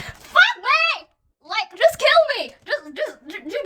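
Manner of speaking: high, loud, dramatic cry of despair; anguished outburst. Real, not acted. Fan reacting to a brutal lyric, loud high wail. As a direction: wail it like the lyric just stabbed you.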